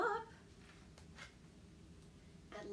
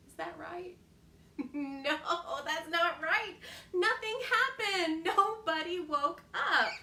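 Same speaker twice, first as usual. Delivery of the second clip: laughing while telling; amused storyteller; 5 semitones higher, 4 dB louder than usual. Real, not acted. Laughing at mistake.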